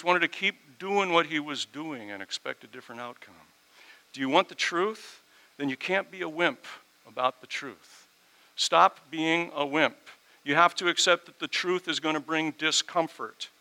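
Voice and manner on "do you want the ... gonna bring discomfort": louder, rising scolding preach; preaching hard. Real, not acted. Preacher scolding the congregation, loud and pressing. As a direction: push them hard to stop being a wimp.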